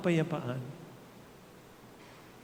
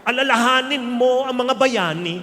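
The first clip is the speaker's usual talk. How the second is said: put-on loud, higher, stern pep talk to a crying child; bracing parent; 7 semitones higher, 8 dB louder than usual. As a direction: tell the kid to toughen up, loud and firm. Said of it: "tapos sinabi mo".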